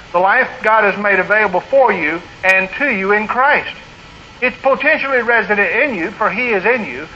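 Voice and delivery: ordinary talk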